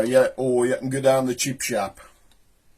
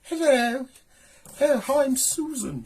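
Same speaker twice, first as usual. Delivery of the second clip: much higher, mock upper class English accent; posh upper class accent; 13 semitones higher than usual. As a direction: put on a dainty posh English lady voice. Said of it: "speaks with an upper class accent".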